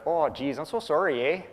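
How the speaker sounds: mock Canadian accent, apologizing; overdone Canadian accent, sorry eh. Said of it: "let's see if I get the accent right".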